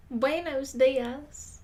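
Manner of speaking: slow, drawn-out Texan twang on Spanish; drawled Southern gringo Spanish; Southern-accented Spanish. Retold or imitated. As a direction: imitate a Southerner attempting Spanish, stretching each word. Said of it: "the people with accents when they try to speak Spanish".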